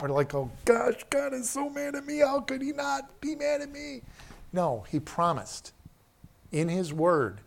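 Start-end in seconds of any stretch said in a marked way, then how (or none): 0.3-4.0 much higher, fretful guilt-ridden voice; whiny worried sinner. Retold or imitated. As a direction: play a panicky believer sure God is mad. Whiny guilty believer quote.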